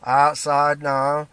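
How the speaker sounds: Roy's drawn-out catchphrase; slow drawn-out catchphrase. Retold or imitated. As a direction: stretch out the word outside, slow. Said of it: "my mate at work used to say to me".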